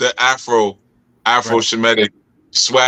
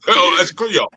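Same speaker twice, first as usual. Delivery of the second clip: high, teasing put-on innocent voice; mock playing dumb; 8 semitones higher than usual. Teasing a friend who plays dumb about Creole. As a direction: feign total innocence, pitched up. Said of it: "You be like".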